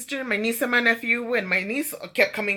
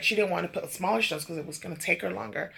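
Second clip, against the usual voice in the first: ordinary talk, nothing marked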